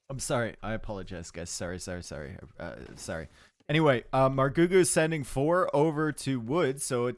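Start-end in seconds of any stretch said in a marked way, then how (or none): 0.1-3.3 much softer, lower muttered sorries; fading, softer sorries; quiet apology. Real, not acted. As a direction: apologize under your breath to chat. Apologizing quietly after coughing fit.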